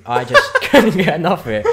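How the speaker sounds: loud, laughing excitement; gushing with a laugh; excited laughing. Real, not acted. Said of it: "I'm so excited".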